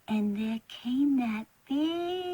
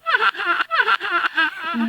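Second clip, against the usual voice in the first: put-on childish laugh; 6 semitones higher, 6 dB louder than usual.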